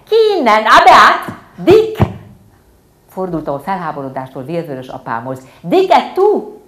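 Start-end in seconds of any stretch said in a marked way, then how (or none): 0.0-2.1 high, very loud shrieks; screaming tantrum. Acted. Little girl screaming at her family to send the orphan away. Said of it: "ordítoztam". 5.6-6.6 high, loud, slow outraged cry; shouted reproach. Acted. Girl yelling at her father in outrage. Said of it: "az ordított mondatot".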